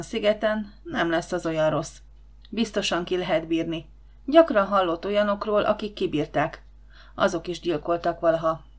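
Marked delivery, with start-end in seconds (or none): none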